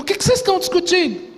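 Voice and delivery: Jesus confronting the arguing groups, high and fast; pressing question. Retold or imitated. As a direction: put everyone against the wall with the question. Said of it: "eu entendo que Jesus perguntou".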